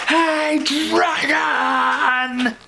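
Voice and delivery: high, loud shout into his ear. Acted. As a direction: yell as loud as you can. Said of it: "She shouted as loud as she could".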